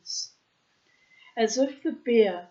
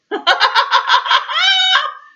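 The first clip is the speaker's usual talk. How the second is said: high, loud shouted laughter; Man backing away laughing loudly; roaring laugh; 13 dB louder than usual. A narrator's reading. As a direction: roar it out. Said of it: "roared Man".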